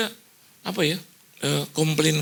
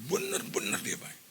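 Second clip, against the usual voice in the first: whispered; 5 semitones lower, 9 dB quieter than usual. Real, not acted. Preacher stresses God's goodness.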